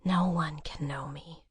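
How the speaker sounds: soft unvoiced whisper; whispered secret to a friend. A narrator's reading. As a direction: breathe it out, no voice at all. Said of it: "she whispered".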